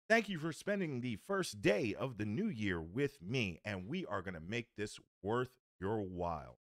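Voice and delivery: ordinary delivery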